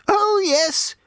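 put-on high, piping little old lady; piped cheerily. A narrator's reading. Old booth lady cheerily waving travellers on. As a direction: chirp it brightly like a tiny old woman. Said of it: "she piped cheerily".

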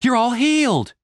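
high, loud overjoyed shout; excited. Acted. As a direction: burst out with relief. Stacy overjoyed at healing.

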